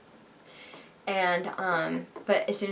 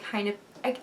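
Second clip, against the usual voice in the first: ordinary delivery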